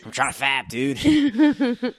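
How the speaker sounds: hammed-up whiny bro, giggling; laughing parody, pitch flying up on dude; mock Comcast guy. Same joke Comcast guy.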